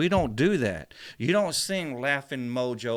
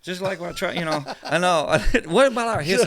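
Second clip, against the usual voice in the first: higher and louder, laughing into it; laughing, fired up; 4 semitones higher, 5 dB louder than usual. Real, not acted. Host laughing as he demands history in school. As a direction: burst out with a laugh and push the point.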